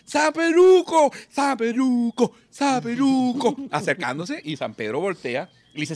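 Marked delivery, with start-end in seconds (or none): 0.0-3.5 high, slow drawn-out calls; slow calling out. Acted. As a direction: call his name across the clouds, slowly. Drunk calling Saint Peter.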